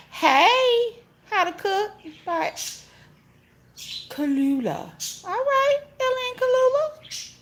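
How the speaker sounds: very high, slow name call; sing-song greeting. Real, not acted. Reading a viewer's name off the chat, very high sing-song greeting.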